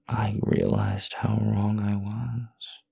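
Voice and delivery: slow breathy whisper. Acted. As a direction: whisper it slowly, close in. Intimate confession to partner.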